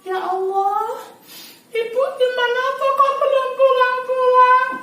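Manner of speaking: put-on high, pleading voice of a fretful child; worried child. Shown as a demonstration. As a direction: whine like a child missing mom. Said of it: "Wajahnya melas". (suara anak kecil cemas memelas, tinggi)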